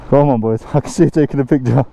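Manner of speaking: ordinary talk, nothing marked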